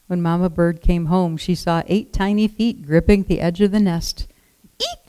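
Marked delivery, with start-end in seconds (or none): 4.8-5.1 a very high, short squeal of fright; shocked shriek. Acted. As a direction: shriek it like a mother bird seeing danger. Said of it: "she cried".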